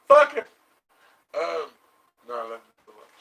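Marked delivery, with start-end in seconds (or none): 0.1-0.4 sudden loud joking outburst. Real, not acted. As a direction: blurt it out loud as a joke. Joking outburst about co-star.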